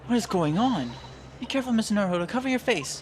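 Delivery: high, urgent acted warning; alarmed warning. Companion warns Mister Naruhodo about the smoke, alarmed. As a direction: call out in mild panic.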